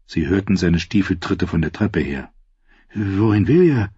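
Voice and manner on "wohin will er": confused. A narrator's reading. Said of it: "fragte Sam verwirrt".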